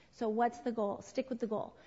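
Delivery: ordinary talk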